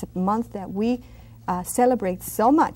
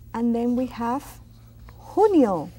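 ordinary talk, nothing marked

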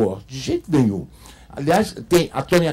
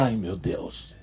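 pitying sigh; 7 semitones lower, 9 dB quieter, slower than usual. Retold or imitated. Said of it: "você fala".

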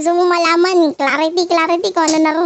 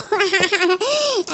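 short burst of laughter; soft chuckling run; laughing; 4 dB quieter than usual. Real, not acted. Reader enjoying herself mid-reading, laughing.

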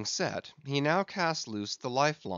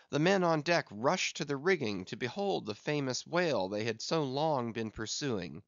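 ordinary delivery

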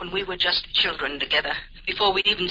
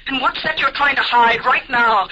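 higher, louder accusing push; pressing demand; 6 semitones higher, 6 dB louder than usual. Acted. Sister presses brother about what he's hiding, rising anger. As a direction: drag the secret out of him. Sister confronts hidden thought.

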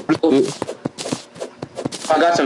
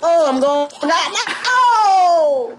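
panicked shriek; much slower than usual. Real, not acted. Player dying in front of friends shrieks high, half laughing. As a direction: squeal as you go down, laughing.

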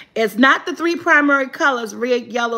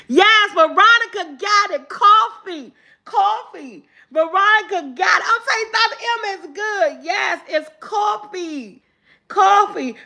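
higher-pitched, delighted confirmation; excited cheer; 6 semitones higher than usual. Real, not acted. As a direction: celebrate the player's right answer. Host cheers a correct guess.

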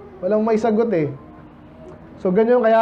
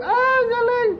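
much higher mock cheer; paid fan cheer; 14 semitones higher, slower than usual. Preacher imitates paid fans shouting praise, high mock cheer. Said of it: "mga sumisigaw ng gano'n, mga bayaran".

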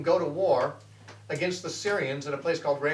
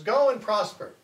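imitating a sarcastic prophet, higher and louder; 5 semitones higher, 5 dB louder than usual. Prophet Micaiah mocking King Ahab, sarcastic. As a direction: toss it off mockingly, not meaning it. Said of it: "but apparently with a sarcastic tone".

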